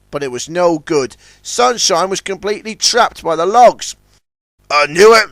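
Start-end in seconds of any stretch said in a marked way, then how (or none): none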